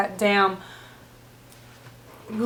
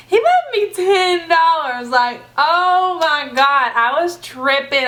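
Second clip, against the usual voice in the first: higher, louder and slow, thrilled by the tip; real excitement; 6 semitones higher, 4 dB louder, slower than usual. Real, not acted. Cashier thrilled at finding a ten dollar tip, excited and slow. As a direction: let the joy spill out, stretching the words. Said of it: "Like, I was so excited".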